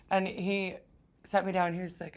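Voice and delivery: ordinary talk, nothing marked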